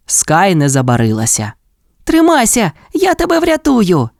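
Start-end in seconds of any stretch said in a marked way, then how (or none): 2.0-4.1 rescuer's shout. A narrator's reading. Skye calls to stranded dinosaur, high urgent cry. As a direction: pitch it way up and call out urgently. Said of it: "крикнула вона динозавру".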